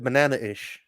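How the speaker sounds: BANANA-ISH with a catch before ISH; glottal stop. Shown as a demonstration. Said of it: "the glottal stop is how we say it".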